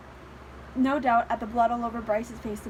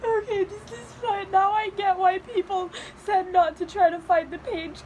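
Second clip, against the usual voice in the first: real crying, pitched higher; tearful; 5 semitones higher than usual. Real, not acted. Reader telling herself this is fine, still tearful. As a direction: gather yourself through tears. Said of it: "I don't care if I'm ugly crying".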